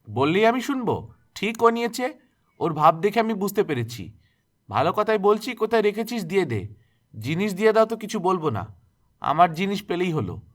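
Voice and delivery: angry accusation. A narrator's reading. Aunt accusing Durga of theft, high and loud scolding. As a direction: press the girl hard, no mercy.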